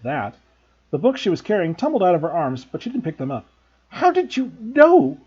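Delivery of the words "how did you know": high, loud, slow shocked sputter. A narrator's reading. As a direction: blurt it out in shock. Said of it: "she sputtered".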